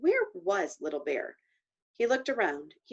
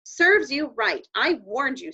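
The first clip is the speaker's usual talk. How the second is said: scolding; 5 semitones higher, 6 dB louder than usual. Acted. Angry scolding of Patrick.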